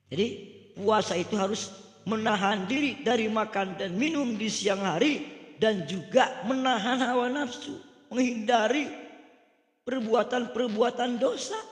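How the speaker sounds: loud, high-pitched sermon summary; emphatic preaching. Real, not acted.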